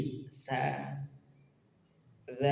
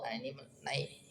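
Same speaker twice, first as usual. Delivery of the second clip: whisper; 10 dB quieter than usual. Real, not acted. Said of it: "whisper aside".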